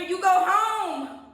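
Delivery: slow, loud and high proclamation; drawn-out loud pronouncement; emphatic declaration. Real, not acted. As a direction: land it like a prophecy. Emphatic card message.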